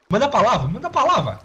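high eager urging; excited urging. Real, not acted. Urging with raised pitch.

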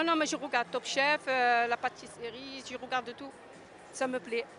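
ordinary delivery

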